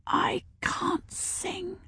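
slow, soft, high whisper; timid child's whisper. A narrator's reading. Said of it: "the girl whispered".

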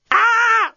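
short, piercingly high robot shriek; very high robot scream. A narrator's reading. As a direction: scream in alarm as a desk robot. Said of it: "screamed the deskbot".

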